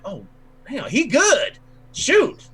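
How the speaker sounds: a patron suddenly catching on and cheering, high and bright; excited tavern patron. Retold or imitated. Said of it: "some of them are like".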